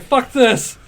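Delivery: mock exasperation, pitched high; exasperated outburst. Colorist giving up on doing math at work, exasperated. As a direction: give up in a high, fed-up cry.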